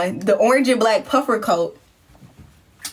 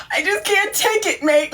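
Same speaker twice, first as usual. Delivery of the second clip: mock crying friend; 8 semitones higher than usual. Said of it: "She's like".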